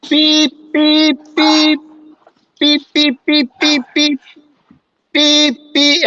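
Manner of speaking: high monotone electronic beeping; short high beeps, mimicking a failing computer; imitated computer beeps. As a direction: squeal out evenly pitched machine beeps. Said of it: "when it starts making them weird sounds".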